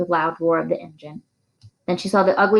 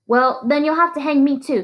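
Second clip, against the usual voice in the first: defiant; 8 semitones higher than usual. A narrator's reading. Defiant teacher to sheriff.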